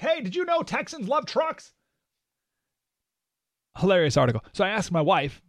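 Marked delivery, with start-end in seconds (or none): 0.0-1.7 high-pitched, mock-amazed announcement; mock reporting back. Reporter reporting back to people in New York, mock amazement. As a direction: share it like an astonishing discovery, pitched way up. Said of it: "it's like they're reporting back to people in New York".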